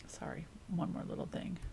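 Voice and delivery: soft whispered apology; whispered aside. Real, not acted. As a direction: whisper it aside, almost to yourself.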